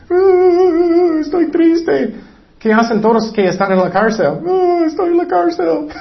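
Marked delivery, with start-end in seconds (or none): 0.1-2.1 high-pitched fake sob; hammed-up boo-hoo wail, much higher; mock crying. Preacher mimicking false repentance that is only crying, mock tearful wail. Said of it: "no es solamente llorando". 4.4-5.9 high, whiny fake wail; mock crying. Preacher imitating prisoners who only cry about jail, mock wail. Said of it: "no es solamente llorando".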